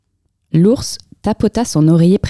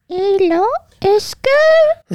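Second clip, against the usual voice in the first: tiny, very high squeak; murmuring mouse; much slower than usual. A narrator's reading. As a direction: peep tentatively from under the covers. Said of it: "elle murmura".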